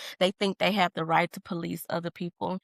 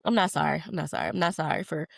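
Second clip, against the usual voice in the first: normal talking voice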